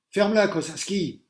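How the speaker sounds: loud high snapped order; teacher snapping at the pupil on the phone; angry order. A narrator's reading. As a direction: shut him up. Teacher losing temper.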